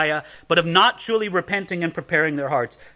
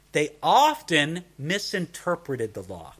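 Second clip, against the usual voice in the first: usual speaking voice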